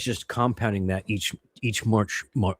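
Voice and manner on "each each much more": tripping over the words; tongue-tied stumble, tired; stuttering. Real, not acted. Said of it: "Sorry, I am stuttering".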